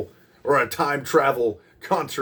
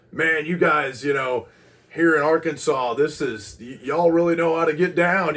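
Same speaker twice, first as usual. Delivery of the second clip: raised, louder mock hype-up of a crowd; imitating fired-up singer; 5 semitones higher, 4 dB louder than usual. Lead singer riling up an Arkansas crowd, imitated fired-up hype. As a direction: rile up the crowd, as a retold quote. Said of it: "getting everybody fired up".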